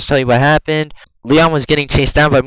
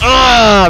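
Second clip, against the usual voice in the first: startled cry; 12 semitones higher, 7 dB louder than usual. Real, not acted.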